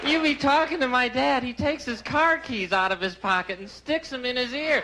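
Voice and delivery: ordinary talk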